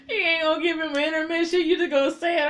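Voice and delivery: high, loud, cracking-up delivery; amused disbelief. Real, not acted. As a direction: exclaim it while fighting off laughter. Laughing disbelief at blurted confession.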